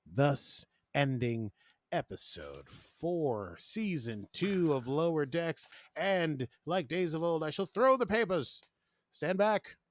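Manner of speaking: slow, rising mock-ceremonial proclamation; pompous showman, pitched high; grand announcement. Host closing the episode before throwing papers, mock-grand.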